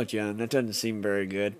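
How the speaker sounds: ordinary talk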